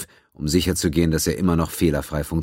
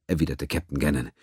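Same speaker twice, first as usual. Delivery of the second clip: ordinary delivery, nothing marked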